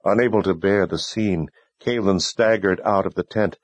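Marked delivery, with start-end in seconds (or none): none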